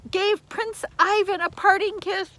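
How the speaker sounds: plain reading voice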